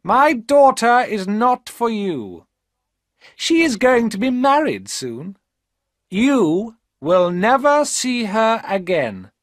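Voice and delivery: high, loud, slow cold refusal; hard old woman. A narrator's reading. Rich old mother refusing the poor suitor, high and loud. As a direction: shut the door on him, slowly. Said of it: "Her voice was hard".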